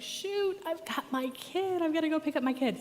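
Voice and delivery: sudden high flustered alarm; panicked. Retold or imitated. Mom realizing she must fetch her kid, high panic. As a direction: blurt it out, suddenly realizing you're late.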